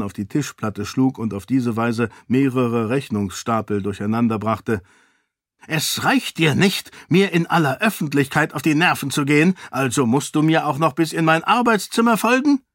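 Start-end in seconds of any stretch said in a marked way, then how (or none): 5.7-12.6 a grumpy king losing patience; irritated king. Acted. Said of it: "wollte er wissen". (verärgerter König, gereizt)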